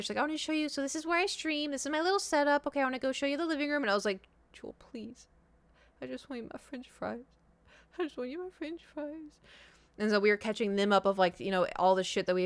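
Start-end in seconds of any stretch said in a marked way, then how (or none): 0.2-3.8 high, breezy imitation of an eager host; excited. Friend showing where she streams, excited. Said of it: "Jules all excited". 4.5-9.4 high, tiny, very soft whimper; feeble pleading. Retold or imitated. Exhausted guest begging for French fries, feebly whimpering.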